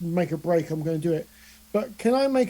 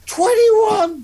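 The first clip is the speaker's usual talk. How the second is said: high, loud cry of triumph; real elated shout; 12 semitones higher, 6 dB louder than usual. Real, not acted. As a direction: shout the number in delighted disbelief. Shouts out a surprise high roll.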